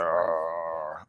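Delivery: low, soft drawn-out growl; imitated dog growl. Trainer showing owners how the dog grumbles, soft mock growl. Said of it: "he's just gonna be like".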